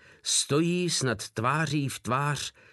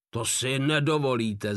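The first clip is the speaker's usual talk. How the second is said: plain reading voice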